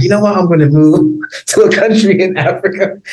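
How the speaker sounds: high-pitched self-quote breaking into laughter; amused self-quote. Retold or imitated. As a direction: announce a wild plan, laughing through it. Mock announcing unlikely move, laughing.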